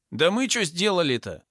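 high, aggrieved moan; lowing protest. A narrator's reading. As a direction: moan high that you did nothing. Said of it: "замычал амбал". (обиженное мычание)

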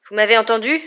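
demanding to be heard; insistent. A narrator's reading. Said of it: "insista Ron".

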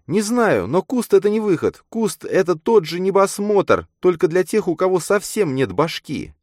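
put-on very high, emphatic girl's voice; insistent girl character. A narrator's reading. Girl urges her friend to widen limits, insistent. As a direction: press the point in a high feminine voice.

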